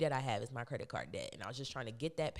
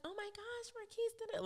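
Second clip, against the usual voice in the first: high-pitched, softly squealed imitation of a manager; excited greeting; 5 dB quieter than usual. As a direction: imitate her excited hello in a small, very high voice. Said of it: "she's so excited to see me".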